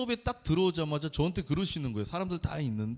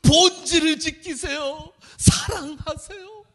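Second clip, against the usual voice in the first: much higher, loud, slow exhortation; mock preachy professor; 11 semitones higher, 8 dB louder than usual. Professor pressing his message on the pastor, slow and booming. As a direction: shout the sermon line like an overbearing preacher. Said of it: "계속 저에게 이 메시지를 계속 주시는 거".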